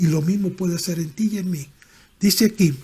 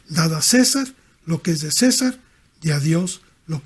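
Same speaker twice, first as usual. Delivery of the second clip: louder, driving scripture reading; forceful preaching; 5 dB louder than usual. Acted. Preacher reading Mark to the congregation, preaching at full force. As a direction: proclaim the verses to the congregation.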